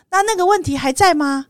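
imitating the polite clerk's question; shop clerk. Clerk calling back asking about the old problem, high mock voice. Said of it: "他跟我说".

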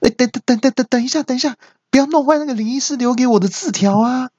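very high, stammering mock alarm; panicked cry. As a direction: shriek to stop her, stuttering on the first word. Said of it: "我大叫一下". (尖声慌张地大叫阻止)

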